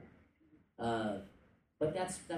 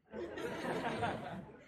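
laugh; 10 semitones higher than usual. Real, not acted. Laughs after sip of coffee.

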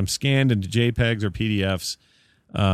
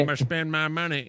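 put-on Southern accent; 7 semitones higher, 3 dB quieter than usual. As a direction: ham up a Southern accent, a bit higher. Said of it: "the Southern accent".

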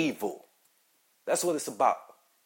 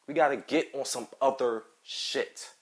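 usual speaking voice

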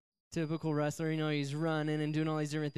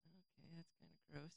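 barely audible imitation of the girlfriend; grossed-out girlfriend; 25 dB quieter than usual. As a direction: mumble it very softly, mock uneasy. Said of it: "And she's like".

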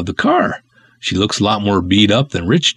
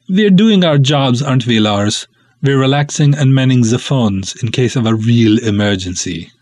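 put-on Scandinavian accent; 3 semitones higher than usual. Uli smugly defends lounging by phones in Scandinavian accent. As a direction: brush off the alarm in a comic Scandinavian accent. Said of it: "way too fun doing those ridiculous accents".

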